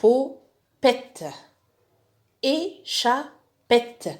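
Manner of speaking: Chipopette and Échappette stretched out very slowly; teacher drilling the names slowly; slow reading drill. Shown as a demonstration. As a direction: drawl each syllable for pupils to copy. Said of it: "تمرنوا عليهم".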